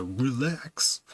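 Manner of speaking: "[name]" sung out high and rising like the synth-pop hook; dramatic eighties "[name]". Retold or imitated. Streamer mocking an eighties song for the chat, high and dramatic. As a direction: ham up the eighties chorus, "[name]" stretched upward. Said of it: "people didn't talk like that".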